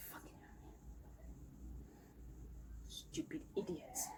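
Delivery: whispered swearing under the breath; whispered curse. Real, not acted. Cursing softly to herself about the noise outside. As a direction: hiss the curse quietly.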